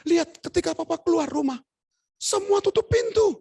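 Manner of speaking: high, loud, emphatic warning; mock wifely alarm; imitated wife. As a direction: build high and loud, slowing on the last phrase. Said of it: "Terus istri saya bilang".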